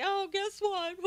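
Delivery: high, gleeful tattletale voice; mocking Durbin tattling. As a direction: blurt it out like a kid telling on someone, pitched high. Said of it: "he had to go out and tell everybody".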